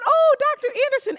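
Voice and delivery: very high, loud mock delight; gushing greeting. Usher welcoming the VIP back, gushing. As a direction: squeal a hammed-up welcome.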